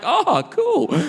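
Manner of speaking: squeaky high, taken aback; startled high "oh cool". Retold or imitated. Comedian answering a rude fan, high and startled. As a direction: sound caught off guard but polite. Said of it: "And I was like".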